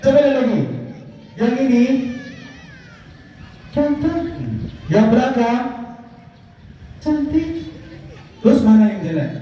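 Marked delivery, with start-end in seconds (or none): 3.7-4.2 high puppet voice, cheeky; monkey puppet voice. Acted. 7.0-7.5 high puppet voice, cheeky; monkey puppet voice. Acted.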